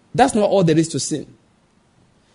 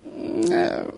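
put-on baby whimper; 13 semitones higher, 8 dB quieter than usual. Preacher imitates a hungry baby.

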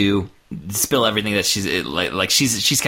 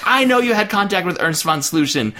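raised-pitch villainous insistence; acted ultimatum, pitched up; hammed-up demand; 7 semitones higher than usual. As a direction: press the accusation like an unstable villain. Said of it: "every time he yells".

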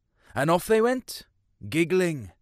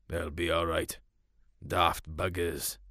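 much lower, softer dismissive grumble; gruff dad; 8 semitones lower, 5 dB quieter than usual. Acted. Farmer dad brushing off his son's worry, lower and softer. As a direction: shrug it off like a grumpy farmer.

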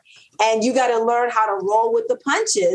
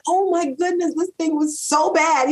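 mortified; 8 semitones higher than usual. Retold or imitated. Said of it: "I was mortified".

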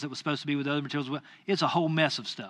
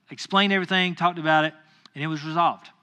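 usual speaking voice

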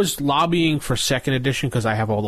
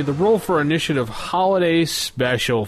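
announcer voice; 4 semitones higher, 3 dB louder than usual. Acted. Podcast host intro.

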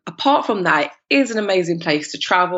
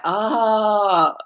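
drawn-out dawning understanding, rising high; mock "now I get it" reaction; acted realization; 4 semitones higher, much slower than usual. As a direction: let the penny drop theatrically. Acting out reaction to her explanation.

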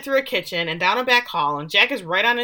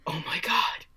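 breathy whisper of shock; stunned gasp; 2 semitones higher, 3 dB quieter than usual. Retold or imitated. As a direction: imitate someone gasping it out in a whisper. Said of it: "he gasps and says".

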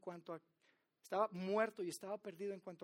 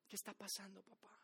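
whisper; 11 dB quieter than usual. Retold or imitated. Brother's complaint ends in whisper.

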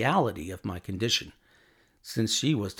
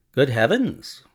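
raised, louder exclamation; higher, forceful burst of surprise; startled cry; 4 semitones higher, 7 dB louder than usual. A narrator's reading. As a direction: cry out in sudden realisation. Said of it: "cried Holmes".

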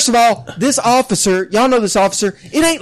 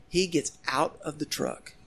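usual speaking voice

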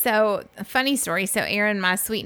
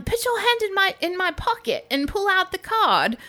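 imitating British guy; 11 semitones higher than usual. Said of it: "turned to Erin and said".

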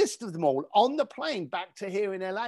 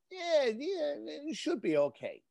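bored brush-off; 9 semitones higher, 5 dB quieter than usual. Retold or imitated. Curator's unenthusiastic reply.

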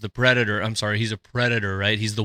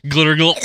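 gargled through a laugh, louder; laughing gargle; 5 semitones higher, 8 dB louder than usual. Pretend. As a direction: gurgle it while cracking up. Repeats gurgle, laughing.

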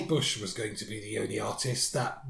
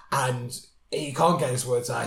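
normal talking voice

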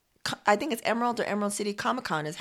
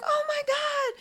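imitating a very high-pitched amazed cry; retold delighted shock; astonished reaction; slower than usual. Retold driver reacts to news.

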